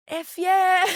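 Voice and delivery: high, loud whoop of triumph; excited cheer. Retold or imitated. Teacher cheering a student's hard-won achievement, high and loud. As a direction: cheer it out like a big win. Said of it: "They deserve a".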